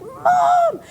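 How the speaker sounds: high, loud drawn-out mock call; imitated child screaming. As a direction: holler for mother like an excited little boy. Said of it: "he started screaming".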